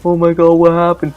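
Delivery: high, loud, frantic oh my god; teen babysitter freaking out, high and loud; panicked babysitter. Retold or imitated. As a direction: play the babysitter panicking at the sight. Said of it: "she's like".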